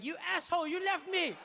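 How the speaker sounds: put-on upset little boy. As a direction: play a tearful kid scolding his papa. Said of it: "this kid was like".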